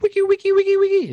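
very high, loud squeak; playful falsetto DJ scratch noise; squeaky intro scratching. Pretend. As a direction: imitate record scratches in a piercing high voice. Playful vocal intro to the show.

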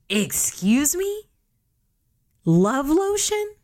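high, slow outraged disbelief; incredulous. Real, not acted. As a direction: stretch it out in disbelief. Mocking ridiculous line.

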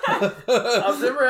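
high laughing start. Real, not acted. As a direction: laugh through the words. Laughing at wrestling story.

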